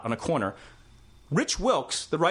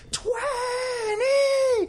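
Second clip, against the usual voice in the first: screamed high and loud like a punk frontman; punk singer's scream; 3 dB louder than usual. Retold or imitated. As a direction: belt it out as a wild stage scream. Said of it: "So he's like".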